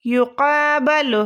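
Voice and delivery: each syllable of یقابل drawn out slowly; slow dictation. Shown as a demonstration. Teacher to students, slowly dictating the word. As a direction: spell یقابل out slowly for the class. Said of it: "وہ کیا بنے گا؟".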